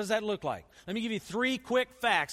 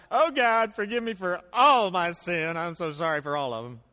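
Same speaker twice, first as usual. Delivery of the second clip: mock vague prayer; 8 dB louder than usual. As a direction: parody a lazy blanket apology to God. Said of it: "It's not".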